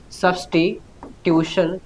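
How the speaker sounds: broken slowly into syllables, "substitution"; slow dictation. Shown as a demonstration. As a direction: dictate it slowly and softly, piece by piece. Teacher dictating the term for note-taking.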